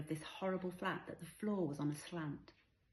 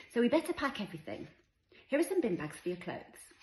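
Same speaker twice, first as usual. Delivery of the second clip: higher, louder, busy chivvying; brisk mum; 7 semitones higher, 7 dB louder than usual. A narrator's reading. Mother organising her daughter to pack bags, brisk. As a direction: get the child packing, bright and practical.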